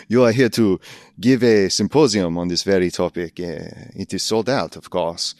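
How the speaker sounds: hammed-up bad French accent of a Paris concierge; a lightly done, cheesy French accent. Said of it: "the worst French accent I've ever done".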